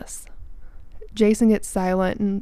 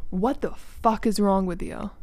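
ordinary delivery

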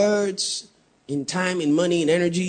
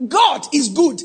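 preached out; 12 semitones higher, 6 dB louder, slower than usual. Acted. Preached loudly before the hush.

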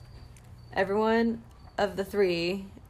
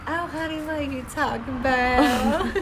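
put-on Southern accent; 4 semitones higher than usual. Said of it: "Just wait for the accent".

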